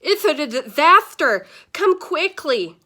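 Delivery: frantic toothless grandpa yell; toothless shouting. Acted. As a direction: stammer it out in a panic with no teeth. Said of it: "He was still shouting".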